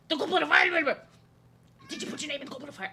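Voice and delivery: high, rushed, frantic yelled line; Dumbledore panic yell. Retold or imitated. Dumbledore yelling at Harry, rushed and high. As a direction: tumble the words out fast in a panic. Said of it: "Dumbledore quote".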